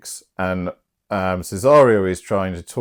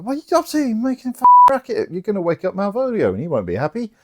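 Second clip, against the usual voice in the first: much higher scolding housemaid voice, rushing at the end; shrill scolding maid; 13 semitones higher, faster than usual. Acted. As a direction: nag them like an exasperated maid. Housemaid Maria scolds the revellers.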